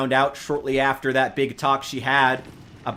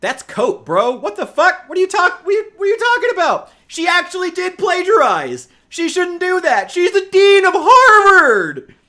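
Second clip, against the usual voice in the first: outraged rant; 9 dB louder than usual. Retold or imitated. Streamer yelling at an academic article, outraged. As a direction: yell it in disbelief, voice climbing till it cracks open. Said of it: "I was like".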